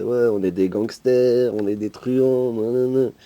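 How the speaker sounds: slow, boastful thug voice; proud gangster voice. Retold or imitated. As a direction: swagger it out like a proud villain. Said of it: "ils étaient fiers d'être le méchant".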